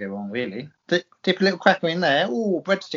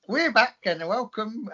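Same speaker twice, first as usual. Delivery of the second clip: Cockney geezer; 3 semitones higher, slower than usual. Pretend. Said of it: "Cockney here, Cockney geezer".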